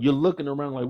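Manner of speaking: usual speaking voice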